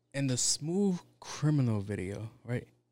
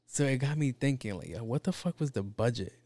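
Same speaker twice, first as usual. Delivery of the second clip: normal talking voice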